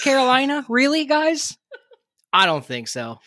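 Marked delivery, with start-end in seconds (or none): 0.0-1.6 high mocking "really, guys?"; sarcastic disbelief. Real, not acted. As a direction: scoff at the idea, way up high.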